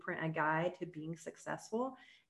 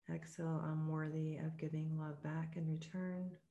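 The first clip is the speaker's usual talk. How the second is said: guided meditation; 5 dB quieter than usual. Acted. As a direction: ease her into calm breathing, gently.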